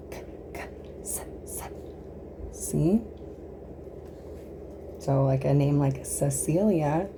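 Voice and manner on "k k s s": voiceless "K, K, S, S"; soft whispered consonants; whispered letter sounds. Shown as a demonstration. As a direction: breathe the sounds, no voice.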